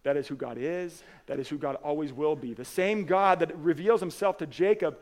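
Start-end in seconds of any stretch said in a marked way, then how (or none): 2.5-5.0 loud, higher preaching climb, slowing at the end; full-force preaching. Acted. Pastor proclaiming God to the congregation, loud preaching. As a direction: proclaim it to the whole room.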